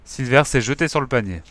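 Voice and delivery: ordinary delivery, nothing marked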